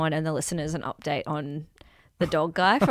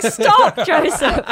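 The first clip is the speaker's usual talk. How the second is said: mock pleading shout; 8 semitones higher, 7 dB louder, much slower than usual. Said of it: "Everyone's like".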